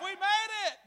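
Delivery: high re-enacted cheer; triumphant announcement. Rafter telling the whole raft, high triumphant cheer. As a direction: announce survival to the whole crew.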